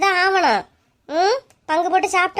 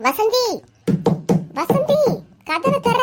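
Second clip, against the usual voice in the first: calling out; 6 semitones higher, slower than usual. Acted. Neighbour calling at the door.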